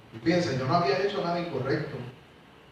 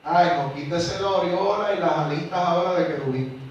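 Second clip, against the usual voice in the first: loud, higher, driving sermon voice; full-force preaching; 4 semitones higher, 7 dB louder than usual. Acted. Preacher driving home the law of Christ to his church, full force. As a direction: hammer the point home to the congregation.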